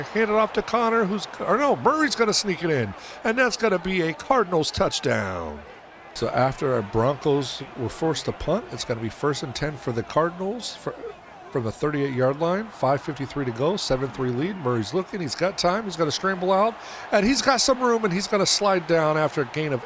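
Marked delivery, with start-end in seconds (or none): 0.0-5.6 excited. Real, not acted. Touchdown call. 15.2-19.8 excited. Real, not acted. Scramble in play-by-play.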